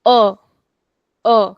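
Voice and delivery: grave A from the tummy; grave burp-like A. Shown as a demonstration. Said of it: "the sound that you take out when you burp".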